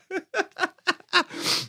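high, quiet giggle; laugh. Real, not acted. Host cracks himself up after his silly bit, laughing. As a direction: let out a string of high, light chuckles.

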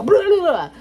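loud, high mock monster cry; popping out of the box to scare mom; scare shout. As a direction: jump out and blast it.

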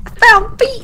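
excited. Pretend. Echoing the found feet line.

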